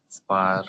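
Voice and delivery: spar with the R drawn out long. Shown as a demonstration. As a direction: stretch the R in spar. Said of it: "elongate it".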